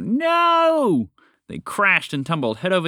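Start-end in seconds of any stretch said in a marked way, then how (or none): 0.0-1.1 long, high, loud cry of alarm; shouted out. A narrator's reading. Phoebe plummeting towards the ground, shouting out. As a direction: shout a drawn-out, high-pitched protest. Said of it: "while Phoebe shouted out".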